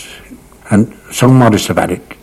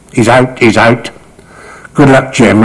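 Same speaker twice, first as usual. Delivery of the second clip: louder, higher mock alarm call; 4 semitones higher, 6 dB louder than usual. Players tricking the next batsman, calling out. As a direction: call it out to send him off. Prank call in dressing room.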